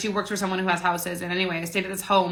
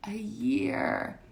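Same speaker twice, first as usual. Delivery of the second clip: breathy aside, "A year." just whispered; a hushed whisper to stress the time; 4 semitones higher, 6 dB quieter than usual. Real, not acted. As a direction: lean in and whisper it for weight. Emphasizing the long wait.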